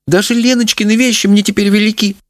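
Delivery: high-pitched, woeful mother; lamenting. A narrator's reading. Mother finding daughter's clothes too big, lamenting. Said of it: "сокрушалась мать".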